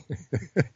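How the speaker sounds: soft breathy chuckle to oneself; real amused laugh. Real, not acted. Host tickled by Floridians bundling up in mild cold, soft chuckle. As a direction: chuckle quietly, genuinely tickled. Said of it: "I'm laughing because this is really funny".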